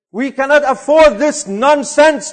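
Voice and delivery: ordinary delivery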